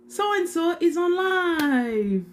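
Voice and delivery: high, drawn-out imitation of an alert; mock notification. Host imitating the alert scrollers all get, mock sing-song notification. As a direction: sing out a mock announcement, slowly.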